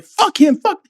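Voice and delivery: high-pitched retold venting; pissed off. Marine venting in his barracks room, acted anger. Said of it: "I'm fucking pissed".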